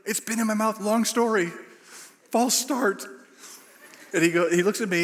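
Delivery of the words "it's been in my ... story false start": high, strained, without the shout; urgent hushed confession. Retold or imitated. Preacher hurriedly explains to lead pastor, high and strained. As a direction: squeeze it out urgently, holding volume back.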